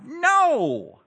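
a loud, high, sudden no; sharp no. Real, not acted. Preacher to the congregation, breaking in with a loud high no. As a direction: cut off the chant with a loud no. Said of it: "preacher rejects self-affirmation".

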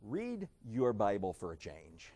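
low, soft, deliberate admonition; stern advice. Acted. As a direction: say it quietly and pointedly, square in the eye. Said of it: "look 'em square in the eye and say".

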